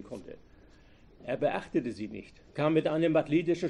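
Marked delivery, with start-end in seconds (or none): none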